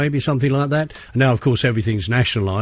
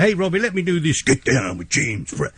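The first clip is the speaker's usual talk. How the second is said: a light [name] impression, excitable; imitating [name]; 4 semitones higher than usual. DJ quoting [name] meeting him at a hotel, lightly imitated. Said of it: "he talks like that all the time".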